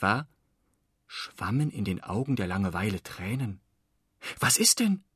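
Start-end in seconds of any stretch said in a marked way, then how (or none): 4.4-5.0 put-on high, alarmed boy's question; dismayed. A narrator's reading. Floh sees Langeweile crying, dismayed high question. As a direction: Floh startled by the tears, asking in dismay. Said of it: "fragte Floh bestürzt".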